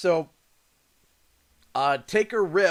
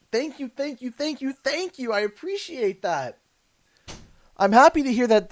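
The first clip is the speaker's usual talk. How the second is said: usual speaking voice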